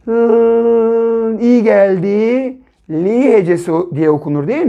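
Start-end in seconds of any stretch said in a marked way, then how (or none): 0.1-1.4 one long, held L sound; drawn-out letter sound. Shown as a demonstration. Teacher sounding out a letter for new readers, drawn out. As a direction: hold the consonant as a steady hum. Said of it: "mon hecesinin başına".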